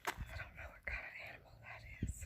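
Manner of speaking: fast hushed whisper. Real, not acted. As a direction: whisper so the animal stays. Near wildlife, whispering.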